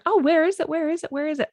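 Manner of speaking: high-pitched mock impatience; acted eagerness, pitched high; eager anticipation. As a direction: ask it over and over like you can't wait. Acting eager people awaiting the podcast.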